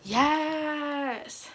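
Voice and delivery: long, high, drawn-out cheer; real triumph. Real, not acted. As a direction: celebrate finding it, stretching the word. Finds the remedy she was seeking.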